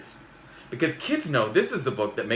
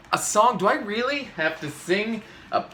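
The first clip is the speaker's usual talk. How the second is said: dismayed; 7 semitones higher than usual. Acted. Dismayed at having to sing.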